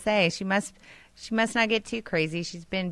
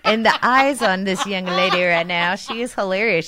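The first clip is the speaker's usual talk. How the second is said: ordinary delivery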